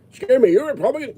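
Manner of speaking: fast pretend canvasser at the door; mock canvasser. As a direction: rattle it off like a door-knocker.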